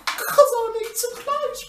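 high-pitched put-on British accent; hammed-up British, pleading to auntie. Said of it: "British accent".

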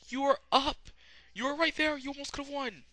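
high-pitched incredulous yelp at the character; mock exasperation. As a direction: squeal it in comic disbelief at his failure. Acted exasperation at the fainting character.

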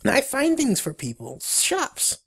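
high, squeaky beaver voice; Billy. Acted. Bargaining beaver introducing its services, high squeaky voice. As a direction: chirp it in a high cartoon voice. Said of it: "exclaims Billy".

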